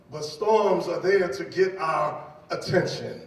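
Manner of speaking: normal talking voice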